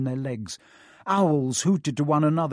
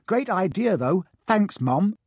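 cheeky; 6 semitones higher, 3 dB louder than usual. A narrator's reading. Twins cheeky.

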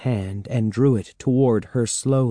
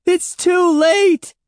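very high, drawn-out shriek; piercingly high despairing yell; 7 dB louder, slower than usual. A narrator's reading. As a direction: shriek it in panic, stretching the words. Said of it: "Andy shrieked".

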